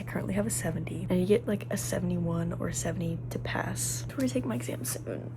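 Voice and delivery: stressed whisper in a quiet room. Real, not acted. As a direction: whisper so nobody hears.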